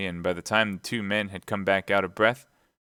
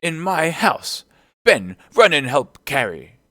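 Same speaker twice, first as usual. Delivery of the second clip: slow, high, loud outrage; outraged landlord shouting to his men; indignant cry; 8 semitones higher, 7 dB louder, slower than usual. A narrator's reading. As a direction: call out orders, stretching the words. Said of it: "cried Silver".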